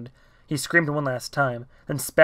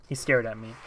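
usual speaking voice

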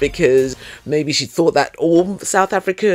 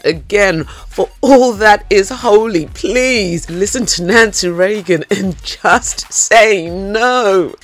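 higher, louder, slow mock-desperate begging; exasperated pleading; 6 semitones higher, 5 dB louder, slower than usual. Real, not acted. Reviewer begging the narrator Rose never to do that accent, higher and louder. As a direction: plead to the heavens, half laughing.

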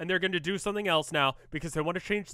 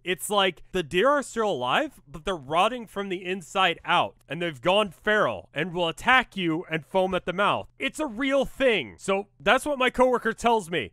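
normal talking voice

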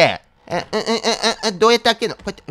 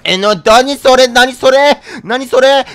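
startled; 6 semitones higher, 5 dB louder, much slower than usual. Real, not acted. Shocked at strange thing.

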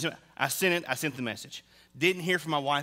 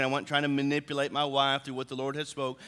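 usual speaking voice

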